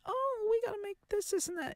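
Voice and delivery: high, far-off sarcastic impression of corporations; mocking corporate voice. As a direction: sneer it like a clueless company exec. Mocking big companies.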